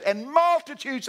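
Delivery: high, loud, stretched-out quote; emphatic proclamation. Retold or imitated. Preacher quoting the Bible to congregation, loud emphasis. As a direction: belt out the scripture, drawing it out.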